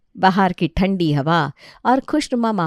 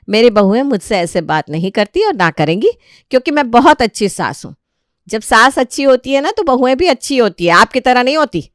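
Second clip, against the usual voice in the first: louder, higher rising indignation; heated argument; 5 semitones higher, 6 dB louder than usual. Acted. Daughter-in-law arguing with her mother-in-law, louder and higher. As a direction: argue her point, getting louder.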